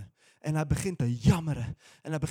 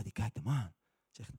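usual speaking voice

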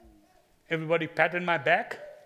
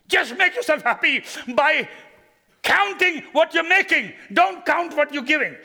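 higher and louder exhortation; full-force preaching; 10 semitones higher, 8 dB louder than usual. Acted. Pastor urges the congregation to count their blessings, loudly. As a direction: drive it home to the crowd.

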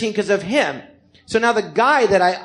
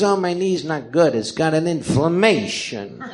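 Cha Cha impression; 2 semitones lower than usual. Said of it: "And he talks like this".

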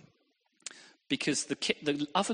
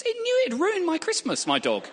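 much higher, louder climax; mock outrage; 7 dB louder than usual. Real, not acted. Preacher hamming up the ruined Christmas, high and loud. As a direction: build to a high, loud peak.

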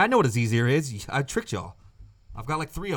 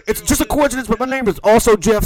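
higher, louder put-on caller voice; second caller Jeff; 6 semitones higher, 6 dB louder than usual. Second Jeff explaining the coincidence, put-on high voice. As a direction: play a silly high-voiced phone-in guest. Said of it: "Let's go to line two".

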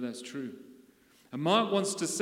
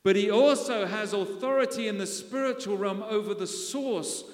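louder, higher, driving sermon climax; full-force preaching; 5 semitones higher, 4 dB louder than usual. Acted. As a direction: build the congregation up with rising fire.

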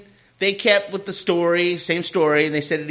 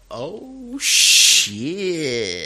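oh shit. Retold or imitated. Both react to racial incident.

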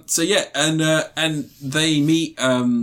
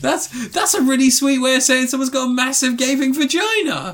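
high laughing voice; 10 semitones higher than usual. Real, not acted. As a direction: speak high through giggles. Still laughing at the joke.

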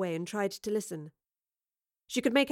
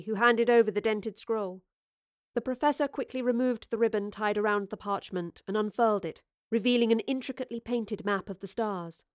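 usual speaking voice

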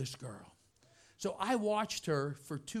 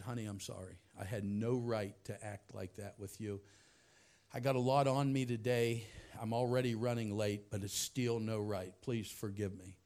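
much softer and lower, tender; tender apology; 9 semitones lower, 5 dB quieter than usual. Retold or imitated. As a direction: say sorry gently to her. Apologising to crying girl.

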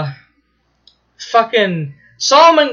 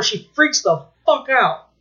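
ordinary talk

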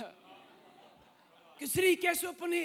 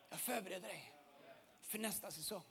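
even softer, lower echo of the promise; hushed repeat; 9 semitones lower, 19 dB quieter than usual. Acted. God repeating the promise to the listener, softer still. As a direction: let it fade into a whisper-like hush.

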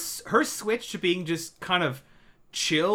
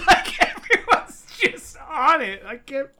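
laughing; 8 semitones higher, 4 dB louder than usual. Real, not acted. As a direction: laugh it out, disbelieving. Laughing at everyone's calm.

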